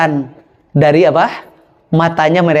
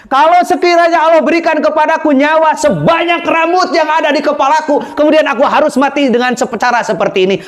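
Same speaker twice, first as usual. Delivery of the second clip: put-on passionate young martyr; 8 semitones higher than usual. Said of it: "Maka pemuda itu mengatakan".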